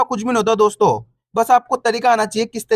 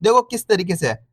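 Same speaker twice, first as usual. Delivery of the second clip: usual speaking voice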